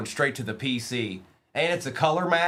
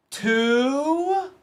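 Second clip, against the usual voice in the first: excited squeal; 12 semitones higher, 3 dB louder than usual. Real, not acted. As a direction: leap way up high on the word. Hit numbered card.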